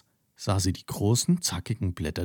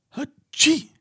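high squeaky cow sneeze; a big ticklish sneeze, pitched way up; tickled nose bursts into a high sneeze; 5 dB louder than usual. A narrator's reading. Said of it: "machte sie".

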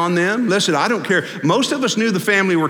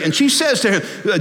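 ordinary delivery

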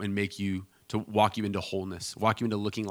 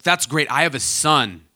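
imitating an eager matchmaking mom, louder; overbearing mom; 4 semitones higher, 7 dB louder, slower than usual. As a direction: lightly play a pushy mother. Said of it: "with an overbearing stranger".

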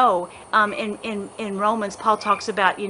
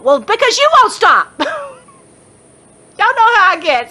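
much higher and louder, getting swept up and laughing; carried-away squeal; 11 semitones higher, 6 dB louder, slower than usual. Real, not acted. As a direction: let the excitement run away with you, then laugh.

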